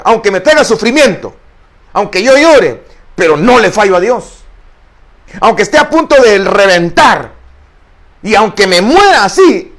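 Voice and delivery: loud, impassioned preaching at full force; fiery preaching. Acted. Preacher vowing to the congregation never to fail God, full-force preaching. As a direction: preach it out, straining toward God. Preacher cries out his vow.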